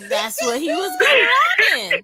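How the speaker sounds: high, loud mocking shout; excited joke. Real, not acted. Host joking about the date's shoes, loud excited shout. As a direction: crow the punchline.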